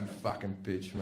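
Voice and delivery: low, soft curse under the breath; acted resentful mutter; muttered aside. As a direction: grumble it privately after the polite reply. Private curse after dutiful reply.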